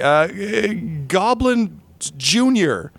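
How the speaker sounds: high, drawn-out groping for a name; stall, then offer it slowly and high, unconvinced; unsure improvising. Acted. Game master making up a dog's name on the spot, unsure.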